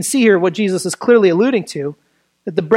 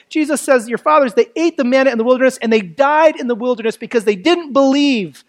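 ordinary talk

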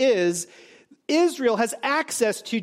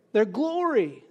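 ordinary talk, nothing marked

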